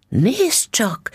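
high, sudden exclamation. A narrator's reading. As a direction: cry out on spotting something. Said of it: "kiáltott fel".

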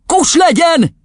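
high, loud father's shout; roaring angry dad; furious yell. A narrator's reading. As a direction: shout the child down. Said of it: "üvöltött rá az apja".

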